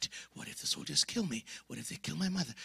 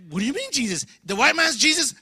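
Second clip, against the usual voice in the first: higher, louder scoffing question; much higher, louder disbelief; incredulous classmate; 11 semitones higher, 9 dB louder than usual. Retold or imitated. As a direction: scoff at the idea.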